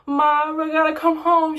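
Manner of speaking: high, distressed plea to mom; upset plea. Retold or imitated. Girl calling her mom from the mall, high and upset. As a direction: beg to come home, voice high and strained.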